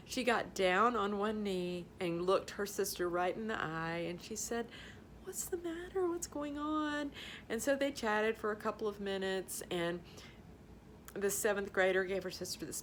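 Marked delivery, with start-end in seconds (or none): 5.2-7.1 gentle comfort. Retold or imitated. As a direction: coax a worried little one gently. Big sister kneels to comfort.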